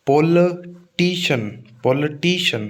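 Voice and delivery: पॉलिटिशियन as pol-uh-TEE-shun; reduced second vowel. Shown as a demonstration. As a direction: hold TEE, end पॉलिटिशियन on a single "shun". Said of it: "पोली नहीं". (पॉलिटिशियन में "पोल-अ-टी-शन")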